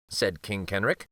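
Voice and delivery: plain reading voice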